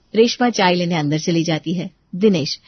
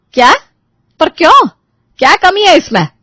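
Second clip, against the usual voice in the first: loud, high-pitched demand; angry disbelief; 11 semitones higher, 6 dB louder than usual. Acted. Mother challenges son refusing the match, angry disbelief. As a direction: fire the questions in indignant shock. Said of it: "सीमा गुस्से में चुप हो जाती है".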